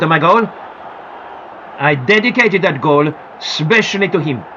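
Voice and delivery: a hint of a proud footballer; footballer quoted. Reads Italian footballer's quote.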